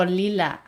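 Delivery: "lila" said as LEE-lah; Spanish pronunciation. Shown as a demonstration. Repeating the Spanish word "lila", pronounced LEE-lah. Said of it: "In Spanish, it's".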